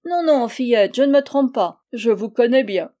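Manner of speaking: put-on high, loud old-man character voice; insistent little old man. Little old man contradicting the girl, insistent. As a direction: insist as a tiny old fellow.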